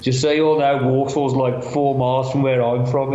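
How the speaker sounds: lightly imitated broad Brummie; a touch of thick Brummie, retold; broader Birmingham accent. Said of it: "he's got a much broader accent".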